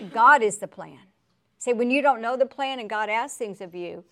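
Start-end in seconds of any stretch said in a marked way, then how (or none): 0.1-1.0 emphatic declaration. Real, not acted. Preacher's emphatic answer.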